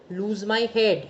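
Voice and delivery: normal talking voice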